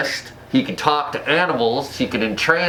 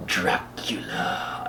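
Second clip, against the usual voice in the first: whisper; 5 semitones lower, 8 dB quieter, much slower than usual. Real, not acted. Recommending the movie to viewers.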